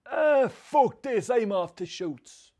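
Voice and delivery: high, slow, drawn-out disgust; fed-up dad storming off. Retold or imitated. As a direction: drag it out like a dad giving up in a huff. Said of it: "and he'd say".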